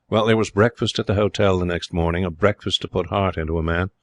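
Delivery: ordinary delivery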